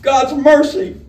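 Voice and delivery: sudden high cry of alarm. A narrator's reading. A targeted scoundrel crying out in fright. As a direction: blurt it out in panic. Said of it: "cried the targeted scoundrel".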